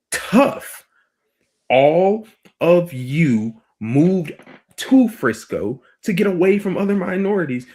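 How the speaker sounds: high, loud, slowly punched-out retort; emphatic "tough luck" comeback; unsympathetic retort. Retold or imitated. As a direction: lay it out slowly and loudly, word by word. Mock retort to Frisco residents.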